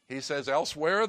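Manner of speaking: normal talking voice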